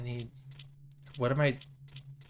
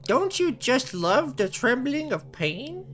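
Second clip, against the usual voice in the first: sadistic captor, philosophizing; 6 semitones higher than usual. Acted. Captor lecturing victim on pain and pleasure, creepy relish. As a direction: savour the cruelty in an odd accent.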